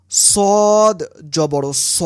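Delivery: সদ জবর স said slowly with rounded lips; rounded sad. Shown as a demonstration. Teacher shows students সদ with a rounded mouth. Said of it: "মুখ গোল করে উচ্চারণ করতে হয়".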